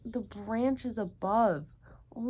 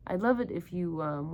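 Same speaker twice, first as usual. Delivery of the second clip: plain reading voice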